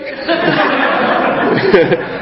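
laughing up high at his own joke; high airy laugh. Real, not acted. Preacher laughing at his jailbreak joke. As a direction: giggle breathlessly.

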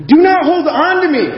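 high, emphatic command; urgent command. Acted. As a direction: raise the pitch and press each word. Acting Jesus's words in reading.

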